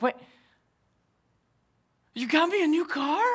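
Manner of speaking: very high incredulous question; stunned disbelief. Retold or imitated. Wife learns of surprise car.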